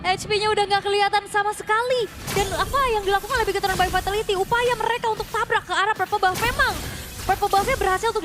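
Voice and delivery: high, loud hype casting; excited esports caster. Acted. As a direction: call the fight with rising excitement.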